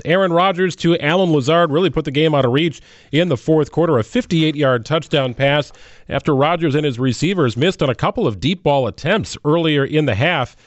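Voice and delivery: usual speaking voice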